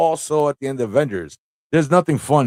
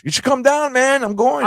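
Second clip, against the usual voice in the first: excited; 14 semitones higher than usual. Real, not acted. Excited invitation.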